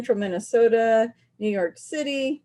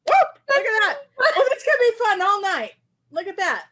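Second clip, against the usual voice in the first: very high, giggly exclaiming; delighted excitement; 11 semitones higher, 5 dB louder than usual. Real, not acted. Seeing the shirt change colour live, delighted and giggly. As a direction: whoop and marvel in a raised voice.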